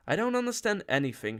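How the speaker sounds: fast, high, firm declaration; brisk, resolute refusal; decisive. A narrator's reading. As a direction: shut the topic down quickly. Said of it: "she said with decision".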